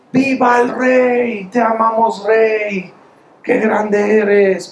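high, slow, hammed-up cheering to the king; mock adoring shout. Tailor shouting praise up at the king from his window, high and slow. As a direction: stretch it out like a fawning heckler.

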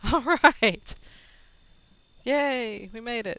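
loud, high celebration; excited cheer. Real, not acted. Celebrating survival.

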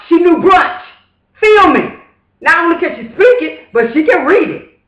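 excited. Real, not acted. Telling listeners about the lady who knew brunch, thrilled. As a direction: gush over it like it's a miracle.

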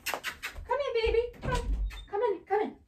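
very high, sweet sing-song; real coaxing. Real, not acted. Mother calling her little girl in, coaxing. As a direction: coax a little one gently inside.

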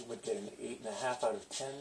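ordinary delivery

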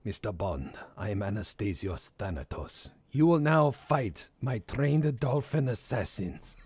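hammed-up Bond villain; méchant à la James Bond. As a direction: announce yourself like a scheming Bond villain. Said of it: "sounds like a fucking Bond villain".

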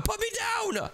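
shrieking at his brother to drop him; high shout. Acted. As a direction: squeal to be let down.

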